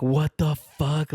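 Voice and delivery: slow, stretched-out freaked disbelief; panicked what the fuck. Retold or imitated. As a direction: drag it out like the panic is just hitting you. Tripping out, panicked reaction.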